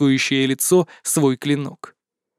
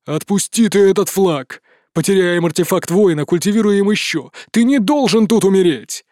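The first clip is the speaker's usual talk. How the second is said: yelling emperor; 3 semitones higher than usual. A narrator's reading. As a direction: bellow it at him in panic. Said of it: "вопил Зер". (отчаянный крик)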